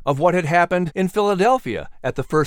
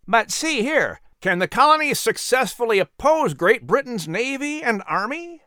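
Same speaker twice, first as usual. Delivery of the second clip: high, anxious doubting gentleman; a nervous man fearing the British navy; worried question; 10 semitones higher than usual. Acted. Worried gentleman doubting Patrick, high and anxious. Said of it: "another man asked worriedly".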